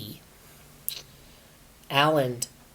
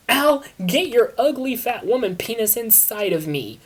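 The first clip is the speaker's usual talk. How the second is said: mock screech; 8 semitones higher, 4 dB louder than usual. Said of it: "Christina screeched".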